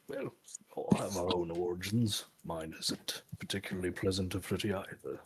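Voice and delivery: soft, low, nearly whispered reply; lightly voiced character, confiding quietly; hushed and understated, in character; reserved in-character voice. Player speaks as their character.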